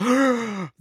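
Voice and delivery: sharp high gasp; shocked gasp. Retold or imitated. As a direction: gasp in horror. Shocked reaction of everybody.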